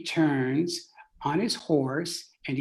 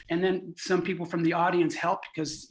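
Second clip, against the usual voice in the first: ordinary delivery, nothing marked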